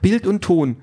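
very high, eager follow-up; excited blurting. A narrator's reading. Carrie adds details of her recording, excited. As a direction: add it proudly in a high, excited voice. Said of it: "stößt Carrie jetzt aufgeregt hervor".

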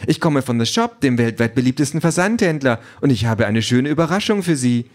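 high, chirpy sales patter; cheerful drone. A narrator's reading. Delivery drone announces a surprise parcel, cheerfully. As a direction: announce the delivery with bubbly pep. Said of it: "sagt die Drohne fröhlich".

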